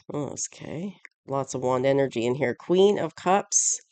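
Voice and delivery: normal talking voice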